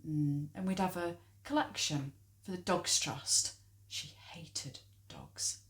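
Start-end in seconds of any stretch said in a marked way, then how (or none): 3.9-5.6 slow, soft, spiteful whisper. Acted. Spiteful aside about the mother.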